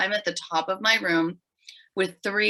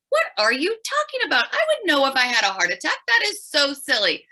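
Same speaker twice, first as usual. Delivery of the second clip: higher, louder mock disbelief; retelling her own dismissive reply, high and loud; incredulous brush-off; 8 semitones higher, 4 dB louder than usual. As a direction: scoff at the doctor as if he's being ridiculous.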